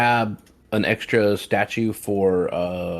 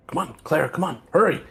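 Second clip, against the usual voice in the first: high-pitched, anxious hurrying-along; urgent urging; 8 semitones higher than usual. Acted. Player urging Clara to hurry, urgent. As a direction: urge a friend on, voice pitched up. Character urges ally to hurry.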